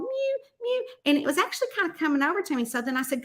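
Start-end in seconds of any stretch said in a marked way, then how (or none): 0.0-0.9 high little imitated mews; kitten mewing. Retelling how the kitten went, little mews. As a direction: mimic a small kitten. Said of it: "it was going".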